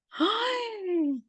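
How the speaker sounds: long, high "ay" of shame; ashamed groan. Retold or imitated. Woman rebuked by God for her rudeness, mortified groan. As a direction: let out a drawn-out, high-pitched mortified groan. Reacting to God's reproach, ashamed.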